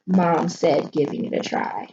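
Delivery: usual speaking voice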